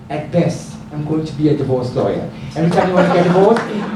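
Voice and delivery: usual speaking voice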